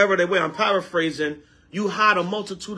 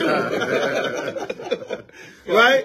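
breathless laugh then a higher "Right?"; 5 semitones higher than usual. Real, not acted. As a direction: laugh it off and ask. Laughing at the dog robbery.

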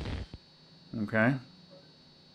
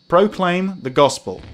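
slow, loud, higher-pitched read-out; deliberate reading; 6 semitones higher, 5 dB louder, slower than usual. Real, not acted. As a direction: announce PROCLAIM and GOSPEL slowly and fully. Rereads it correctly after stumbling.

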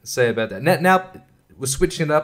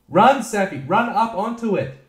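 much higher, eager urging; urging the dog; 10 semitones higher than usual. Real, not acted. Owner urging dog Safi up, much higher voice. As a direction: cheer her on to jump.

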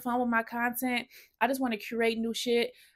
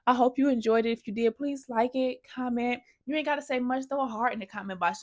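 usual speaking voice

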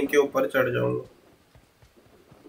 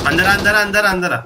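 urgent shout; 7 semitones higher, 4 dB louder than usual. Real, not acted. Urging teammate inside.

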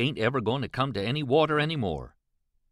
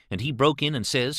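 ordinary delivery, nothing marked

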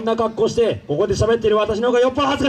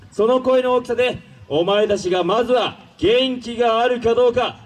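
ordinary talk, nothing marked

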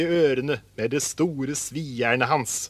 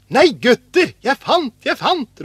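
high, loud cry of discovery; excited shout; 9 semitones higher, 7 dB louder than usual. A narrator's reading. Askeladden calls to his brothers about a find, shouting. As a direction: call out thrilled at a find. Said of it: "ropte han".

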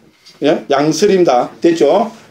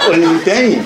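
껄뱅이 in broad Gyeongsang dialect, chuckling; slower than usual. Shown as a demonstration. Monk joking to listeners in Gyeongsang dialect, laughing. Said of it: "경상도 말로 뭡니까?".

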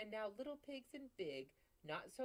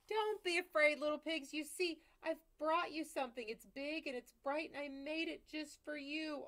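higher, louder sweet-talking wolf; polite wolf; 9 semitones higher, 8 dB louder than usual. Acted. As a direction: coax the pig with overdone politeness. Wolf reassures the pigs.

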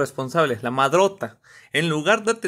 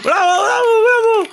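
loud, high shouted bravos; mock cheering; 9 dB louder, slower than usual. Sarcastic audience to fallen bearers, loud high cheering. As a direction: whoop the praise like a wild crowd.

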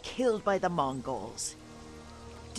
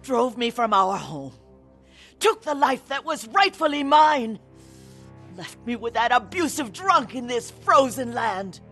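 raised, impassioned resentment; bitter grievance; 3 semitones higher, 5 dB louder than usual. Acted. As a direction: spit out years of wrongs. Sister lists her grievances.

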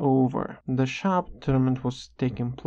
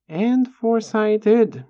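higher, louder and slow, savouring each word; triumphant declaration; 7 semitones higher, 6 dB louder, slower than usual. Real, not acted. Boasting to viewers about crushing his opponent, triumphant. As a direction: proclaim it like a victory.

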